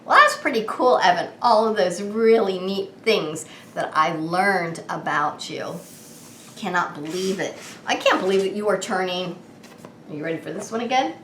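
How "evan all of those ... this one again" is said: ordinary delivery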